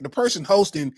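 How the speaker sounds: normal talking voice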